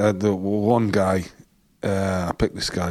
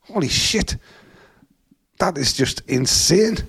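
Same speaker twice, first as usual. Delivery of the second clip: higher, slowed stunned curse; amazed exclamation; 7 semitones higher, slower than usual. Retold or imitated. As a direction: let the shock land slowly. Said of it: "I was like".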